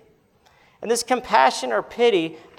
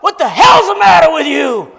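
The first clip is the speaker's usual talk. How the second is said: loud, much higher furious yell; angry shout; 10 semitones higher, 13 dB louder than usual. Retold or imitated. Neighbor yelling at the stung seven-year-old, furious shout. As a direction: bellow at the screaming boy. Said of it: "he says".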